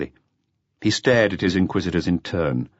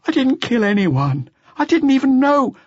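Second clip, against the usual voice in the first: high, loud, frantic denial; desperate protest; 5 dB louder than usual. Acted. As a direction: protest in panic. Accused youth protesting innocence.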